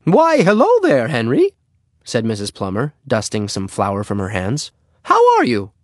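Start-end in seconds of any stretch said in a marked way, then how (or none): 0.0-1.5 very high, loud singsong greeting; effusive neighbor lady. Acted. Neighbor greets the boy at her door, high and effusive. 5.0-5.7 very high, loud neighbor-lady voice; Missus Plummer. Acted. Neighbor asks the boy how he is, high and effusive.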